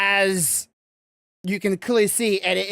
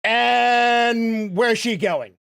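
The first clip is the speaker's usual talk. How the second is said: startled warrior. Acted. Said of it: "the warrior's going".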